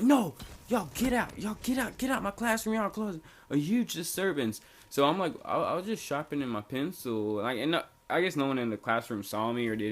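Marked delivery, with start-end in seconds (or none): 0.0-4.6 angry substitute teacher. Retold or imitated. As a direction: order them out in a lightly voiced exasperated teacher. Substitute teacher throwing students out.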